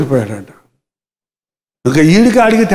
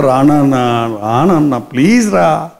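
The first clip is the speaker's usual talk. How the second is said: pleading; 7 semitones lower, slower than usual. Retold or imitated. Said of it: "బతిమలాడుకుంటున్నాడు".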